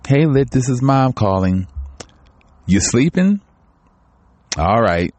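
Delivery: lightly voiced grandmother, pitched a little up; grandmother's voicemail. Grandmother leaving a message for a grandchild who missed her call, lightly imitated. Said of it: "she left me a message".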